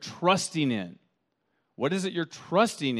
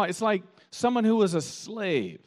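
ordinary delivery

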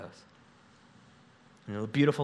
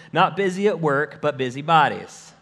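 louder, punching "Not busy at work, but busy bodies."; forceful rebuke; 3 semitones higher, 7 dB louder than usual. Acted. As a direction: hit "busy bodies" hard like a scolding. Reading Paul's rebuke aloud.

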